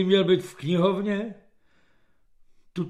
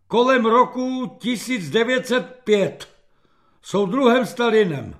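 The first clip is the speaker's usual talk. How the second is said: raised, loud wild answer; blurted guess; 5 semitones higher, 5 dB louder than usual. A narrator's reading. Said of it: "a potom plácl". (hlasitě plácnutá odpověď)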